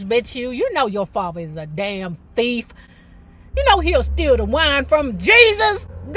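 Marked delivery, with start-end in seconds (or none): 3.6-6.1 loud higher scandalized shout; mock outrage. Real, not acted. Host exclaiming about the thieving father, loud outrage. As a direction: call it out, scandalized.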